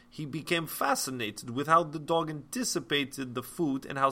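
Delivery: a light, hammed-up Russian accent; reading the textbook in a mock Russian accent; terrible accent. Teacher reads about Pavlov in a mock Russian accent. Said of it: "Sorry for terrible accent".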